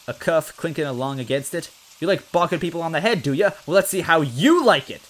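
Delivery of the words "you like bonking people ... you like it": loud, high vengeful shout; angry threat. Acted. Officer threatening Luigi, loud vengeful shout. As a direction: shout it before the blow.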